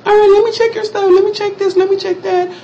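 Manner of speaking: very high falsetto; lady with an attitude. Retold or imitated. Hospital lady checking in a patient, falsetto attitude. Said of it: "With an attitude".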